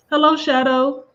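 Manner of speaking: bright greeting. Real, not acted. Greeting viewers in chat.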